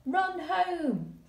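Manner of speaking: high, loud hurried call; urgent exclamation. Shown as a demonstration. Teacher showing how the exclamation sounds, high and urgent. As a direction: make it sound like a dash for home. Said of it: "we want to get home really, really quickly".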